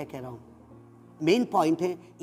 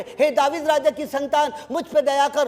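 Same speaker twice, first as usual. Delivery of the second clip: raised, ringing cry for mercy; loud plea; 8 semitones higher, 4 dB louder than usual. Retold or imitated. Believer crying out to Jesus, loud plea. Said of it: "आप कह रहे".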